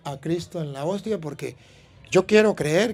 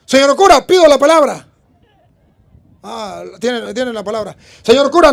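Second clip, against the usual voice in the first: put-on loud, higher, eager boy quoting verses; boy proving his point to the bishop; confident child reciting; 7 semitones higher, 11 dB louder than usual. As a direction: pipe up proudly before the bishop. Boy answers bishop reciting scripture.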